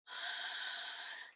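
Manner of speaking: long, audible breath for calm; demonstrated deep breath. Shown as a demonstration. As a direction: show a slow calming breath. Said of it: "stop, take that breath".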